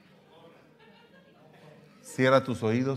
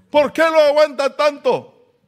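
much higher, loud, shrill-toned outrage; indignant gossips; 11 dB louder than usual. Retold or imitated. Critics asking why she puts up with him, loud outrage. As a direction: cry out like scandalized neighbors. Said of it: "hay reclamos".

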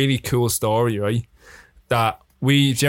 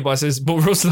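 ordinary delivery

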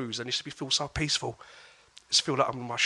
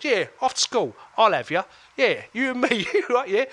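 cocky challenge; 9 semitones higher, 6 dB louder than usual. Retold or imitated. Challenging the biggest bully to fight.

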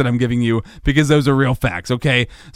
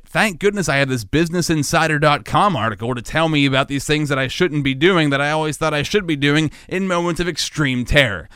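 ordinary delivery

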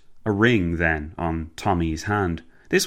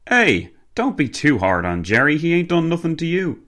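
higher, louder driver voice; Cockney driver, protesting; 9 semitones higher, 4 dB louder than usual. Acted. Driver defending his mate Jerry to his passenger, protesting. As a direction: lift the voice in chummy Cockney protest.

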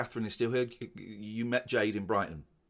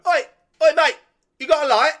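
imitating a high, loud holler; shouted workman's call; 14 dB louder, faster than usual. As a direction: yell over to the other side of the road. Retelling workmen shouting across road.

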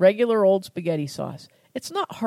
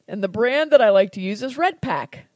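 normal talking voice

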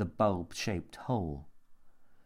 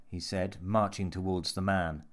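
plain reading voice

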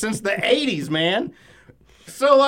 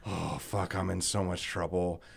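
much softer and lower, muttered under the breath; lower, softer: quietly realizing you are in trouble; muttered panic; 12 semitones lower, 12 dB quieter than usual. Retold or imitated. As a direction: mutter it to yourself in dread. Inner panic, muttered to himself.

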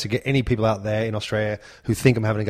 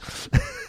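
breathy giggle; 9 dB quieter than usual. Real, not acted. Laughs mid-impression.

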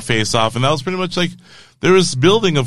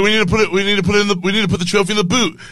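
higher, fast fake British accent; bad British accent; 8 semitones higher, much faster than usual. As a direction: rattle it off in a shoddy mock-British accent. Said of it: "like, a shitty British accent".

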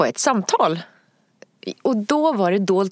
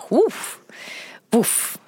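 mimicked sudden puff of sound; imitated whoosh; 4 semitones higher than usual.

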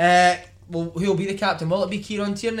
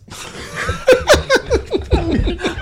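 big laugh; 4 dB louder than usual. Real, not acted. Laughing at own story.

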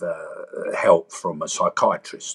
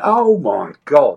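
retold dismay; 5 semitones higher, 6 dB louder, slower than usual. Facing the tap routine.

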